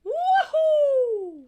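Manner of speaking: loud, high whoop; cheering. Real, not acted. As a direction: celebrate with a whoop. Cheering for the trivia winner.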